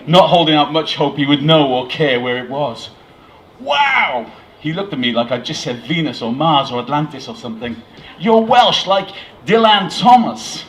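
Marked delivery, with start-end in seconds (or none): 3.6-4.3 amazed American, high and put-on; hammed-up high American astonishment; bad American accent. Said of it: "a terrible American accent". 8.2-10.6 loud, high, awestruck American; bad American accent. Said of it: "a terrible American accent".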